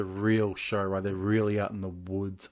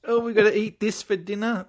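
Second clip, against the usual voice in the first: lightly voiced posh camper, a bit higher and louder; mock posh camper; 10 semitones higher, 4 dB louder than usual. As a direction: sneer it like a pampered vacationer. Mocking rich campers' dinner.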